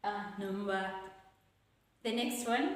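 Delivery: normal talking voice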